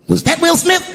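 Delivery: teasing crowd question, voiced high; imitating Chris Rock. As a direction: play it sly to the crowd. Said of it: "looks at the crowd and goes".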